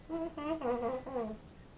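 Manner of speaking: high chuckle, trailing down; laugh. Real, not acted. Amused at own chocolate rant, chuckling laugh.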